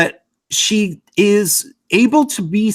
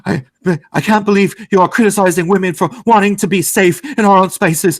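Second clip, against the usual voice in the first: slightly higher, fast, mock-offended voice; a touch of exaggerated outrage; mock hyperbolic outrage; 3 semitones higher than usual. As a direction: parody her overblown indignation, lightly. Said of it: "doing the whole hyperbolic, acting like".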